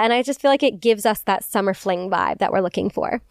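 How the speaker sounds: ordinary talk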